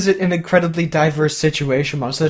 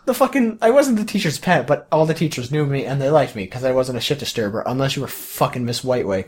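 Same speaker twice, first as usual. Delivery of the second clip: ordinary talk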